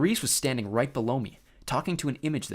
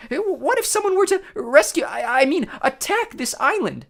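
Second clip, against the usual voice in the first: very high squeaky stammering; nervous falsetto; 10 dB louder than usual. A narrator's reading. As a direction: stammer in a fake bride voice. Grover as nervous bride.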